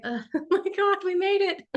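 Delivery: high, breathy mock relief with a laugh; relieved exhausted everyone. Host imitating everyone at the portal, high laughing mock relief. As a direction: play the whole group gasping we survived. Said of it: "but we're all just like".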